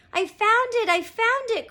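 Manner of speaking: loud, very high exclamation; excited cry. A narrator's reading. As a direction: cry out a thrilling discovery. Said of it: "cried Jack".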